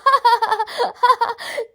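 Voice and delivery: high amused burst of laughter; big teasing laugh. Acted. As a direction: laugh off the child's fear. Didi laughs again.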